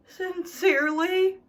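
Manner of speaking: long-suffering sigh and sincerely. Acted. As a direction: sigh out the farewell like a martyr. Weary sign-off to letter.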